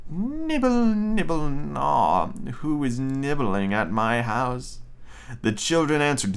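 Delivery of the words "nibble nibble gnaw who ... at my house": soft witch call. Acted. Witch calls from the parlor to the nibblers, soft and drawn out. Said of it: "a soft voice cried from the parlor".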